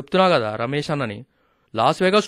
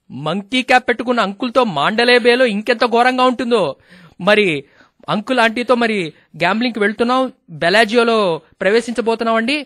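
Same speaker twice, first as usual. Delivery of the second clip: higher, lively show-host delivery; radio host narration; 6 semitones higher, 5 dB louder than usual. Acted. Host updates the listeners from Bellagio, lively.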